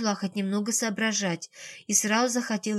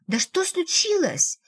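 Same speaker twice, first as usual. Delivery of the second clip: much higher, slow indignant question; petulant demand; 9 semitones higher, slower than usual. A narrator's reading. Ксения demands an explanation, high-pitched and petulant. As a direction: stretch the question out, high and huffy. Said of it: "топнула ножкой девушка".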